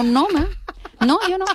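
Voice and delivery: high, playful Venezuelan-tinged denial; Venezuelan accent. Acted. Customer confessing to Venezuelan waiter, playful Venezuelan accent. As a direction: deny it teasingly in a Venezuelan lilt. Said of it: "yo por diversión imito acentos".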